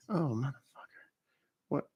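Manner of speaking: usual speaking voice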